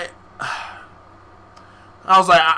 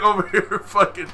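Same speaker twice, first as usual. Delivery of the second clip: normal talking voice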